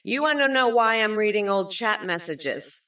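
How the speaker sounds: ordinary talk, nothing marked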